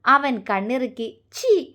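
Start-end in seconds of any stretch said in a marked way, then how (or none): 1.3-1.6 high, coy little whine; a shy, playful protest. Acted. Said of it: "അവൾ ചിണുങ്ങി". (നാണത്തോടെ ചിണുങ്ങൽ)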